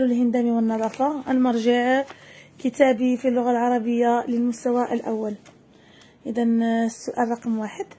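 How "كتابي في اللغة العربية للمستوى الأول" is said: normal talking voice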